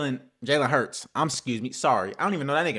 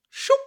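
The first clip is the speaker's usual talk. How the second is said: whoosh noise; 5 dB louder than usual. Retold or imitated. Imitates ball going through hands.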